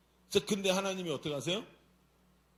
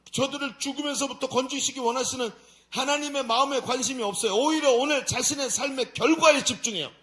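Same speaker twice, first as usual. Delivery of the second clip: high, loud sermon delivery; forceful preacher's sustained raised voice; impassioned preaching; 7 semitones higher, 7 dB louder than usual. Real, not acted. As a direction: drive the point home to the congregation.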